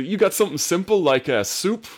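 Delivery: high, loud plea for plain food; hopeful Willie. Retold or imitated. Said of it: "Oh, she".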